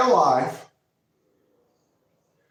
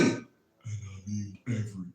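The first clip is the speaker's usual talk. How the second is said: very low, much quieter rumble on and I mean every; deep voice; 11 semitones lower, 12 dB quieter than usual. Shown as a demonstration. Host repeating the line in his deep voice. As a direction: sink into a bass growl. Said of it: "that was my deep voice".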